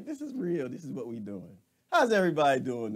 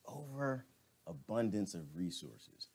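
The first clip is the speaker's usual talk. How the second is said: Overabundance of resources said slow and softer, savoring it; slow, quiet repeat; 4 semitones lower, 9 dB quieter, slower than usual. Shown as a demonstration. Vlogger repeating a viewer's comment back, slow and soft. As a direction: repeat the phrase slowly to let it sink in. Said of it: "repeated back slowly".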